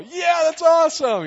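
high, loud mock cheer; guys cheering. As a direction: play the guys loving it. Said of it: "all the guys are all".

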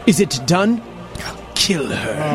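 low, ominous order; sinister husband. Acted. Husband over speakerphone to hired kidnappers, sinister. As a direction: play the husband coldly ordering a killing. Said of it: "It was her husband's voice".

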